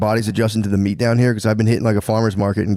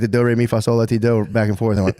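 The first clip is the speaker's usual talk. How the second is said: ordinary delivery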